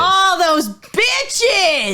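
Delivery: high, drawn-out indignant cry; mock outrage. Co-host reacting to the temperance union's win, mock-outraged cry. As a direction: draw it out in hammed-up outrage.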